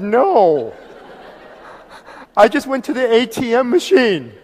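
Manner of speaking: mock protest. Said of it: "I said".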